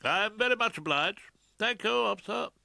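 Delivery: high, loud, pompous thanks; self-satisfied counsel. A narrator's reading. Defence counsel thanking the officer, smugly. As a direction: thank him with great satisfaction.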